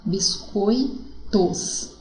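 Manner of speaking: "Biscoitos" very slow, broken into bis-coi-tos; "Biscoitos", syllable by syllable; split syllables. Shown as a demonstration.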